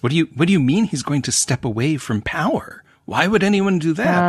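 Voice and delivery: baffled disbelief, pitched high on the questions; incredulous. Acted. Host voicing the king who can't believe they'd do this, incredulous. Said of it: "he's incredulous that they're even gonna do this".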